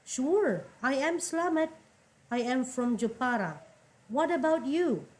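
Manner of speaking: teacher voicing the second speaker of the dialogue; second man's line. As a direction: answer as the second man, friendly. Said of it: "Dijawab oleh yang orang kedua".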